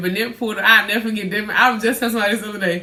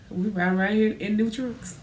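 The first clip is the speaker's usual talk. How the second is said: usual speaking voice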